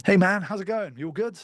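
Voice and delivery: friendly energetic greeting to a prospect; with some energy. Shown as a demonstration. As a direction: warm up the call with a lively hello. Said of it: "with some energy".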